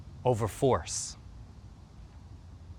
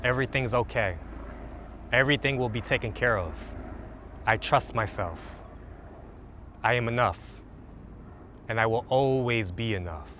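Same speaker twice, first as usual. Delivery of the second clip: guided meditation; 2 semitones lower, 3 dB quieter than usual. Acted. Coach leading listeners through a calming routine, soothing meditation voice. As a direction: guide them gently into the body.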